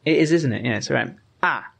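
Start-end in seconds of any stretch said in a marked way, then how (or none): 1.4-1.7 "ah" falling straight down; fourth tone. Shown as a demonstration. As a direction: drop "ah" sharply downward. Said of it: "it's just going straight down".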